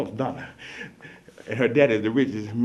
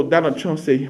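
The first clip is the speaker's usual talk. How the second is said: ordinary delivery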